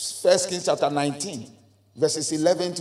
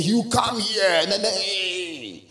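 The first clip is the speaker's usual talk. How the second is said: drawn-out mock preacher's call; lightly put-on revival preacher; imitated showy preacher; slower than usual. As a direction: act out a preacher's theatrical outburst. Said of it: "then he was saying".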